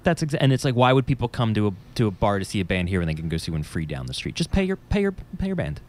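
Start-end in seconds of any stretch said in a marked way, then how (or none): 4.3-5.8 exasperated. Real, not acted. Exasperated plea.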